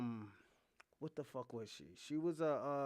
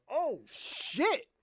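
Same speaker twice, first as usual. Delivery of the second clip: high startled oh shit; shocked; 8 semitones higher than usual. Retold or imitated. Narrator reacting to the wife, high shocked. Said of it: "I was like".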